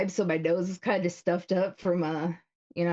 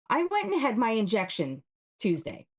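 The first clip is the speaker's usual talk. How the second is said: normal talking voice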